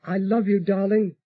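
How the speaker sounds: ordinary delivery, nothing marked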